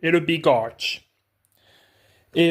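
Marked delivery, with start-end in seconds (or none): 0.2-1.0 English name in an American accent. Shown as a demonstration. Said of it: "l'accent anglais, américain, peu importe".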